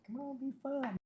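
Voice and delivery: soft, high put-on voice; demonstrating a high character voice. Shown as a demonstration. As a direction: pitch it well up, softly, so it's not a dude's voice. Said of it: "do a higher pitch so it's not like a dude's voice".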